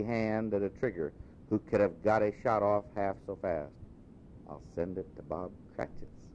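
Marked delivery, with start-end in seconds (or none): 4.5-6.2 gleeful whisper. Acted. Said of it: "whispered Scrooge".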